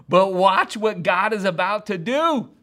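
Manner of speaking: full-voiced excited anticipation; triumphant proclamation. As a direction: declare it with rousing faith, drawn out. Preacher acts out triumphant faith.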